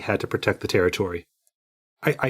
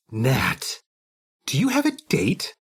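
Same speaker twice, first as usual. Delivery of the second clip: exaggerated gasp, then rising high; scandalized friend, leaping up in pitch; mock shocked; 5 semitones higher, slower than usual. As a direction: tease with theatrical astonishment. Teasing friend playing shocked at Nat.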